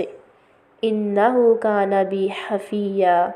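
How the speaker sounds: slow, drawn-out chanted Arabic; Quranic recitation. Shown as a demonstration. Teacher recites Ibrahim's gentle reply, slow chant. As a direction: stretch the verse out as tilawat.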